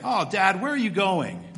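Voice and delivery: lightly voiced disappointed son; little boy whining. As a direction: whine it like a let-down little kid. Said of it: "You can hear the little boy saying".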